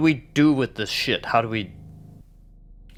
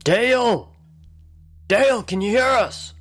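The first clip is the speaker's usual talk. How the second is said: calling out; 10 semitones higher than usual. Acted. Player calls down the hole after Dale, calling out. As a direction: call out to someone far off.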